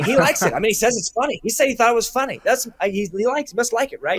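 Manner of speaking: bubbling over, half asking; giddy. Retold or imitated. Retelling their giddy reaction to his email, excited.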